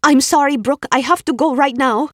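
put-on hurried Swedish-accented apology; Ingrid's Swedish accent. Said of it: "in the Swedish accent".

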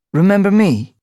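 high, loud pointed question; a lean cat daring the guard to recall him; Boulder's challenge. A narrator's reading. Boulder confronts old acquaintance.

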